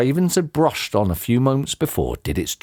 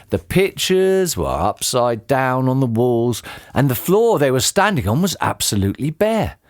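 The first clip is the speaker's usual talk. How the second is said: ordinary delivery, nothing marked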